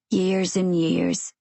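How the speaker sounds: slow, drawn-out West Country man; a lightly voiced West Country caravan dweller, unhurried; gentle West Country drawl, slow; West Country accent. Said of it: "His accent is thick West Country".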